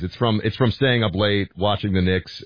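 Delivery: usual speaking voice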